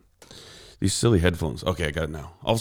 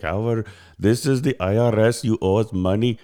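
imitated Indian-accented scam caller; 2 semitones higher than usual. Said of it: "with, like, an Indian accent".

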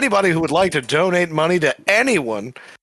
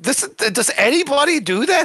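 mock baffled Vigilante; 9 semitones higher than usual. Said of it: "Vigilante's like".

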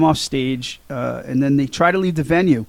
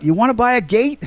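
higher-pitched, mild mock holler; mock yell; 8 semitones higher than usual. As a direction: imitate a yell without really screaming. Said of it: "Dick screams out".

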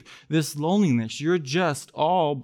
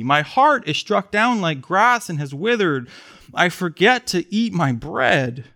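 raised, earnest reading of the psalm; scripture reading; 4 semitones higher, slower than usual. Acted. Pastor reading the psalm to the congregation, earnest. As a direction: read the lament with weight. Reads psalm verses aloud.

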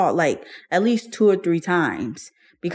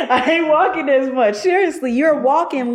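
laughing; 9 semitones higher than usual. Real, not acted. Laughs at her own weight gain.

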